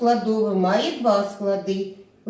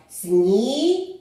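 «Сніговик» slowly split into syllables; голосно по складах; 4 dB louder, slower than usual. Shown as a demonstration. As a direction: sound out «Сніговик» clearly, syllable by syllable. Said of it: "Давайте промовимо голосно по складах". (протяжно й чітко, по складах)

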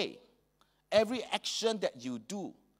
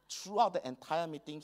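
normal talking voice

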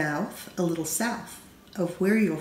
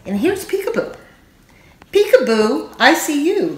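playful peekaboo; 7 semitones higher, 5 dB louder than usual. A narrator's reading. Grown-ups playing peekaboo.

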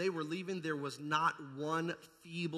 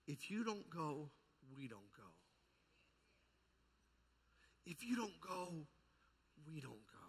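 soft plea; 6 dB quieter than usual. Acted. Moses pleads with God.

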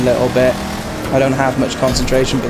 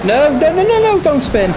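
panicked high pleading. Real, not acted. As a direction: beg the car not to spin. Car about to spin out.

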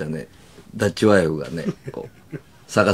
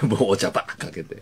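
usual speaking voice

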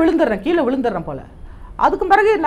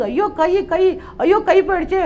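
higher, mock wail over a lost arm; half-acted cry of horror, pitched up; retold anguished cry; 5 semitones higher, slower than usual. Blast victim recalls seeing his arm, mock wail. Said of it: "கத்துறேன்".

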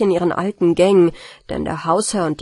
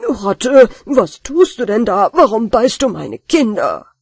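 annoyed landlord, louder; ärgerlich; 6 dB louder than usual. Acted. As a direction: demand an answer from the culprit. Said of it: "fragte ärgerlich". (ärgerlicher Hausherr, laut)

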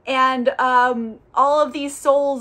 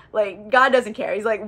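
normal talking voice

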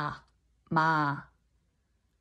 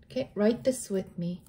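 ordinary delivery

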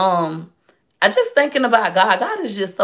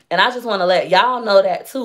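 ordinary talk, nothing marked

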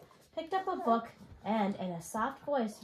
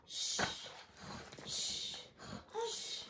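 whispered hush fading out; soft shushing; 11 semitones higher, 9 dB quieter, much slower than usual. Acted. Reader hushing the house of sleepers, whispered. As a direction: shush like everyone is asleep.

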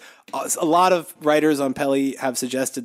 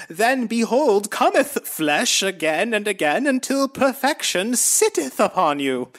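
high, slow, solemn biblical preaching; lofty old-timey Jesus voice, drawn out; 7 semitones higher, slower than usual. Retold or imitated. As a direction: intone like a pompous prophet. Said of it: "Here's some more Jesus".

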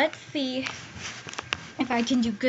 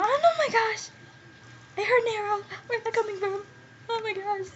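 startled; 10 semitones higher than usual. Real, not acted. Hears an arrow, panics.

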